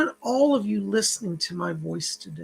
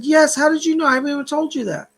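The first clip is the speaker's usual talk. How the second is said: higher, quickening astonished retelling; 7 semitones higher, 5 dB louder, faster than usual. Retold or imitated. Caller amazed that the psychic knows about the job, astonished. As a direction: imitate an amazed caller, pitch jumping up.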